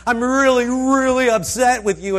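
high, loud mock complaint; hammed-up upset sheep voice. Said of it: "the way they express their upset".

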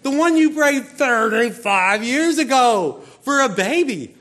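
loud, high, slow and emphatic; hammed-up exasperated angel; exasperated correction. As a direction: spell it out loudly to someone not getting it. Angel correcting baffled Zechariah.

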